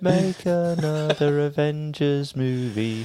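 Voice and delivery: slow, high, hammed-up charity slogan; mock charity appeal. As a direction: plead it like a charity ad.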